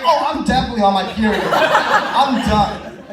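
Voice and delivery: fast, loud, laughing panic; panicked inner voice. Retold or imitated. At the party, thinking he's on his period, loud laughing panic. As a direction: blurt it out in mock horror.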